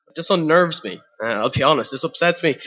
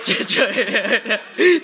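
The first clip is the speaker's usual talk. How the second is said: high laugh; 8 semitones higher than usual. Real, not acted. Laughs at his own joke.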